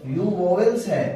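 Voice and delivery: ordinary talk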